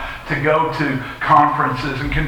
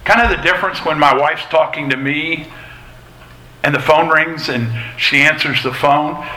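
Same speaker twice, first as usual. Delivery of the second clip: ordinary delivery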